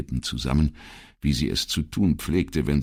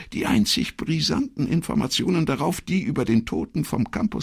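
much higher female character voice; earnest secretary. Acted. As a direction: explain as the secretary, pitched way up. Voices Elettra in dialogue.

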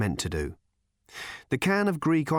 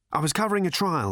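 raving journalist; 7 semitones higher, faster than usual. Acted.

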